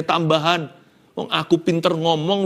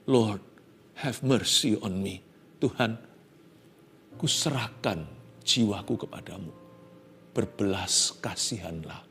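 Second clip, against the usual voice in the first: humble prayer; 7 semitones lower, 9 dB quieter than usual. Acted. Jacob surrenders to God in humble prayer, softly. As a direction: pray it humbly, barely above a murmur. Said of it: "dengan rendah hati".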